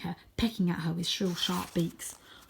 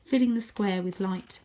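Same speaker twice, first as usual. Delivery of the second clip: plain reading voice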